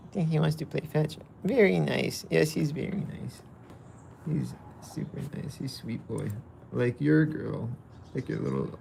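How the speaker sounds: normal talking voice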